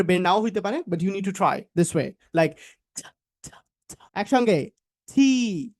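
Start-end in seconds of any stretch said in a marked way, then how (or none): 5.1-5.7 "থি" drawn out after a puff; slow, breathy-onset "থি"; aspirated T. Shown as a demonstration. Teacher ends the sound sequence, aspirated T. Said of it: "এটা হচ্ছে থি এর উচ্চারণ better করার একটা way".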